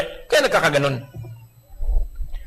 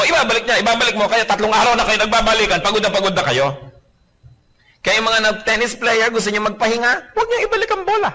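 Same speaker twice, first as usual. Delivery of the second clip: ordinary talk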